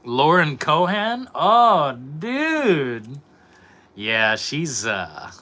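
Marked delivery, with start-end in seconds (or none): 0.0-3.2 higher and louder, slow and swooning; light up at her name; excited gush. Real, not acted. Streamer hearing his crush named, higher, louder swoon. Chat names his favorite actress.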